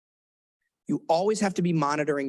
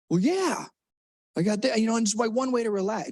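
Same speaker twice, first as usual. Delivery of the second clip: lightly voiced kid, defensive; 7 semitones higher than usual. Role-played kid defending.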